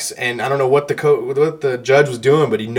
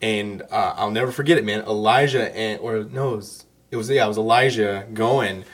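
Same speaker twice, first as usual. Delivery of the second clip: normal talking voice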